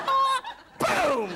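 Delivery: high loud yelp, then booming impact; loud comic crash noises; imitated slamming and shrieking. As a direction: act out the crash with a cry. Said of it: "she would slam into the chair behind me".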